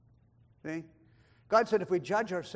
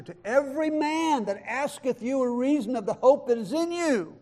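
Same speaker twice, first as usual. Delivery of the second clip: raised, loud proclamation of scripture; impassioned preaching; 6 semitones higher, 6 dB louder than usual. Real, not acted. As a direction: proclaim the verse with mounting force. Preaches verse at full force.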